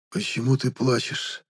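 soft, slow hushed voice; hushed question. Acted. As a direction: ask it gently under the breath. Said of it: "шёпотом спросил я". (тихим шёпотом)

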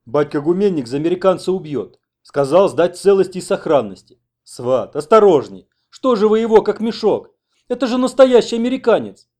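loud, rising, worried outcry; agitated scolding. Acted. Man warns carriers to mind the American, agitated scolding. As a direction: fret loudly and build to a high shout.

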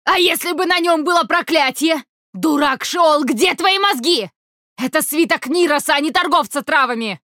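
high furious demoness tirade; angry scolding. Acted. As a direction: berate him, outraged.

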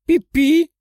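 high squeaky questioning chirp; tiny animal squeak, asking; squirrel squeak. A narrator's reading. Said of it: "спросил Цезарь".

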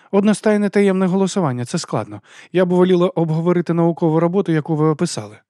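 lightly voiced high official; formal woman official. A narrator's reading. Official steering the teacher back to his paper, businesslike.

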